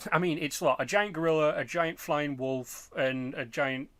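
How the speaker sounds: usual speaking voice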